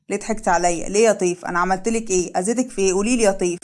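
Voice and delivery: plain reading voice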